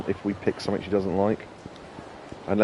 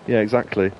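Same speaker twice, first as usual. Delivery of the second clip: ordinary talk, nothing marked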